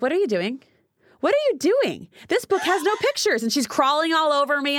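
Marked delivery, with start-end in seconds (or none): 0.0-3.4 put-on high whiny child's protest; mock kid voice, high and pleading; imitating daughter. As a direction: mimic a five-year-old complaining, pitched up. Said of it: "was like".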